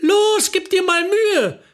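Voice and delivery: high, drawn-out urging shout; acted high-pitched holler; impatient call. A narrator's reading. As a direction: call out to egg a friend on. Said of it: "rief Renate".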